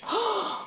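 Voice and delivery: quick high gasp of shock; sharp gasp. Acted. Reader gasps before the transformation word, sharp gasp. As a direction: gasp as if you can't believe it.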